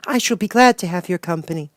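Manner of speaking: put-on a curious young girl asking; Dorothy. A narrator's reading. Dorothy asks the Wizard how, lightly girlish.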